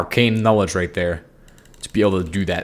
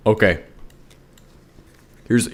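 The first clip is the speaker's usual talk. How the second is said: normal talking voice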